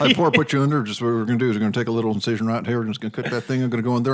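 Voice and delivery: thick Alabama country doctor. Acted. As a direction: rattle it off as a backwoods Southern surgeon. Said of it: "or a Southern Alabama hick accent".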